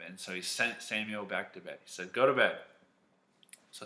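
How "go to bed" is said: loud grumpy order. Acted. Tired Eli sending Samuel back, grumpy and loud. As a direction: send him off with a mock cross bark. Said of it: "Eli was very grumpy and very tired".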